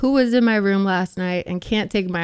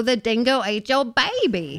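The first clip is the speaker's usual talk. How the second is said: put-on Australian accent; 3 semitones higher than usual. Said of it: "We were working on some accents".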